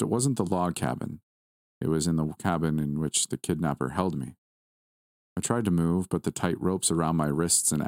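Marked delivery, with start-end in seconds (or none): none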